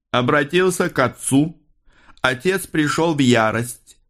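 high-pitched, detached recital; speaking into space. A narrator's reading. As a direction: announce it high, to nobody in particular. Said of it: "произнёс Икар в пространство, никому конкретно не обращаясь". (высоким голосом, в пространство)

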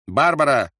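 loud, high calling out a name; calling shout. A narrator's reading. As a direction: call her from the room. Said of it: "крикнул Рольф". (громкий зов)

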